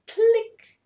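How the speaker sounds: high, loud click sound; camera click. Acted. Imitating a camera taking the photo, high click. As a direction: snap it like a shutter.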